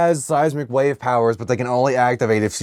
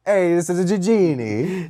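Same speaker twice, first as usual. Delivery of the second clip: announcing a magician act; grand showman intro; 6 semitones higher than usual. Retold or imitated.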